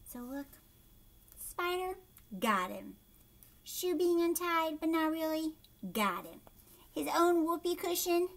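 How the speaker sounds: usual speaking voice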